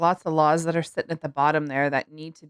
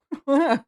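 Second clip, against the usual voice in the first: laughing; 9 semitones higher, 3 dB louder than usual. Real, not acted. Co-host laughs after joke.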